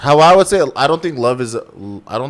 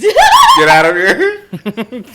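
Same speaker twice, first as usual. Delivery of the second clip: slow, high, loud laughing yell; hammed-up shout to send him away; mock shout; 13 semitones higher, 9 dB louder, much slower than usual. Joking to his girlfriend about the solicitor, a laughing mock shout.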